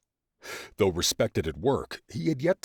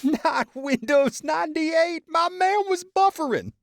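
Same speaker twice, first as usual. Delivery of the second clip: wailing with laughter; 6 dB louder than usual. A narrator's reading. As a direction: howl it through tears of laughter. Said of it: "wailing laughter".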